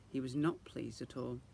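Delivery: ordinary delivery, nothing marked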